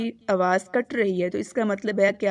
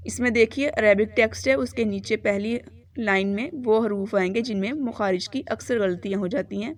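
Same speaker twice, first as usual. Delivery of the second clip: ordinary delivery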